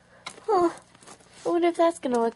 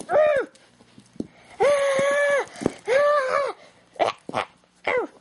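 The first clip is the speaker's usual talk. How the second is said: high-pitched mock scream; short pretend shrieks of alarm; play scream; much slower than usual. Acted chase and fight.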